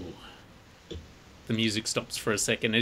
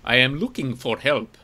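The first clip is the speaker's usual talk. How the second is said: put-on stranger's thick, foreign-accented English; 2 semitones higher, 6 dB louder than usual. Cloaked stranger seeking help at the bar, thick accent. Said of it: "he says in a thick accent".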